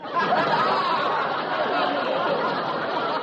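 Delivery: loud high laugh. Real, not acted. Costello laughs at his own comeback. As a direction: laugh at your own joke.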